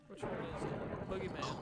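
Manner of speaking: loud. Real, not acted. Boss spawns, loud reveal.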